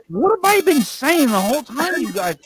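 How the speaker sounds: exasperated exclaim. Retold or imitated. Retelling own exasperated reply.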